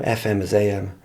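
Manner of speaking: usual speaking voice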